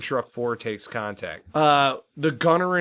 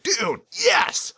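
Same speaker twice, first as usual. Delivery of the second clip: excited. Retold or imitated. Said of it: "I'm like".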